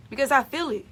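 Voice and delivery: ordinary delivery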